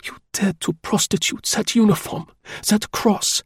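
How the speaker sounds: woman condemning his disguise in quiet fury. A narrator's reading. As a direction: spit out her contempt without raising the volume. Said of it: "with an intense, quiet fury".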